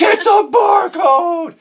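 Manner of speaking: very high, loud, slow yell; furious gamer shout. Retold or imitated. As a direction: bellow it out in rage.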